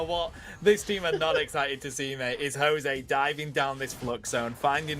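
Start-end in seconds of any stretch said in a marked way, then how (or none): none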